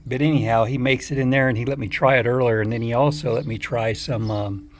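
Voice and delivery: ordinary talk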